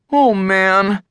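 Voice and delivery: high-pitched, dismayed sigh; lightly acted dismay. A narrator's reading. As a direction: sigh it out as a mild groan of dread. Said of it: "Eugene sighed".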